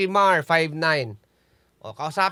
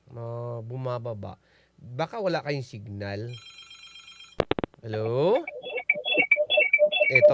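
ordinary talk, nothing marked

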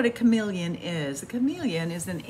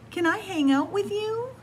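much higher, pleading little question; hopeful chameleon; 11 semitones higher than usual. Acted. Reading to kids, the chameleon asks the sock in a high hopeful voice.

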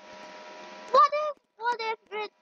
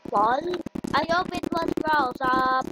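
usual speaking voice

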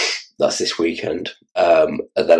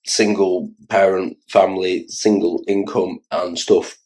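ordinary delivery

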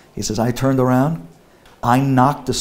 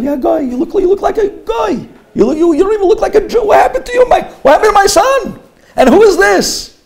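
put-on loud, high, rushing old father rant; father dismayed at his son and the shiksa, loud and high; 10 dB louder than usual. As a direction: berate him in disbelief.

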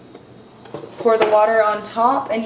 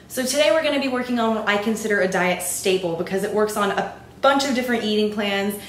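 normal talking voice

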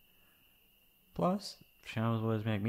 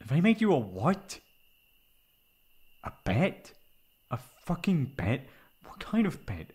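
louder, higher outrage building; outraged; 5 semitones higher, 8 dB louder than usual. Acted. Friend learns partner dated them on a bet, furious. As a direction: erupt in disbelief at the cruelty.

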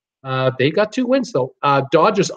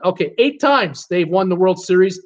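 usual speaking voice

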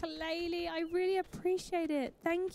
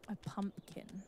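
hushed whisper; wildlife watching whisper; 5 semitones lower, 10 dB quieter than usual. Real, not acted. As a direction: whisper so nobody hears. Sneaking near the goose.

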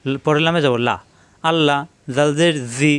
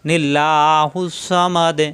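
slow chanted tilawat with long drawn vowels; Quran recitation; much slower than usual. Shown as a demonstration. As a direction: recite slowly, stretching each vowel. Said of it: "এই নি মিলবে এই লামের সাথে".

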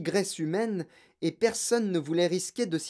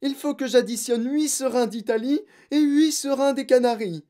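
high, loud, frantic pleading; desperate schoolboy; pleading cry; 10 semitones higher, 6 dB louder than usual. A narrator's reading. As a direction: beg for time like a panicked pupil. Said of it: "cria-t-il".

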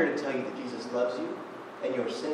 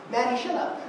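laughing, higher playful jab; laughing retort; 9 semitones higher than usual. Real, not acted. Preacher laughing to girlfriend on kayak, playful jab. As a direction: shoot back mid-laugh.

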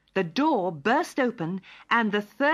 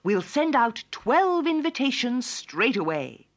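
a touch of royal decree, higher; lightly voiced king; 6 semitones higher, 4 dB louder than usual. A narrator's reading. King orders the invitations sent, lightly regal and higher.

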